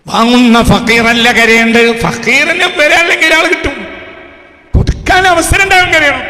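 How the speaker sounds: loud, high-pitched preacher's shout; full-force preaching. Real, not acted. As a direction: thunder it at the congregation. (ഉച്ചത്തിൽ അലറിയുള്ള പ്രസംഗം)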